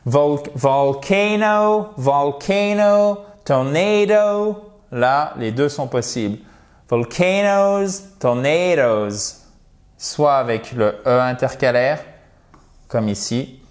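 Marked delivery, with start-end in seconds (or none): 0.1-4.7 very slow, stretched VOLCANO and TORNADO with long AY; high held endings on each word; model pronunciation. Shown as a demonstration. Teacher shows words to students, slow demonstrative pronunciation. 6.9-9.4 slow VOLCANOES, TORNADOES with long stressed AY; model pronunciation. Shown as a demonstration. Teacher shows both plurals to students, slow demonstrative pronunciation. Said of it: "l'accent tonique".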